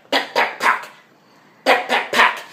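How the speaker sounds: imitated slapping noise. Mimicking sex sounds in show.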